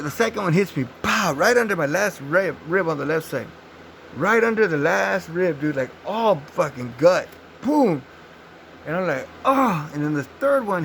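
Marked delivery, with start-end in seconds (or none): 1.0-1.3 high shouted pow; gunshot pow. Retold or imitated. Second bullet hits, high shouted pow. 7.6-8.0 high shouted boom; gunshot boom. Retold or imitated. Bullet in the gut, high shouted boom. 9.4-9.9 high loud cry of pain. Retold or imitated. Shot in the gut, high pained cry.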